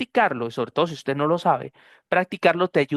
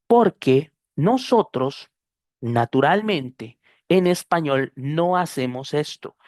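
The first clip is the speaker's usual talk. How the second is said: slow emphatic; slower than usual. Real, not acted. Teacher stresses point slowly.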